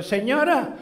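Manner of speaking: high granny voice answering a customer; voiced old lady. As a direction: play the kindly old lady at the counter. Con man and old shopkeeper voiced.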